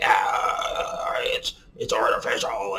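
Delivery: drawn-out mock complaint with a long "ah"; mocking complainers. Vaper mimicking people who complain it's artificial, mocking. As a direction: imitate a tiresome objector.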